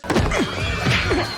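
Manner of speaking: loud, high fake cough; exaggerated cough. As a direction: cough to cover it up.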